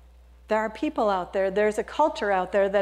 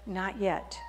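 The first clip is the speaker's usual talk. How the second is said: softer, lower master; calm refusal; 3 semitones lower, 8 dB quieter than usual. Acted. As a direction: calmly deny it.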